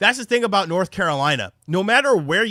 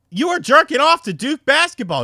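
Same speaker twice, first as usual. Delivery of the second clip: incredulous accusation; 9 semitones higher, 4 dB louder than usual. Retold or imitated. Catching friend in the act.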